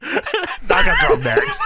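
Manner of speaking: high, slow, laughing announcer parody; mock sign-off laughing. Host parodying Lauren's camera sign-off, high slow laugh. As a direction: drag out the sign-off while cracking up.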